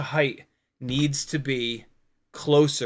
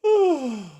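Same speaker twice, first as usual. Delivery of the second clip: imitated yawn; 12 semitones higher, 4 dB louder than usual. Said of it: "you're like".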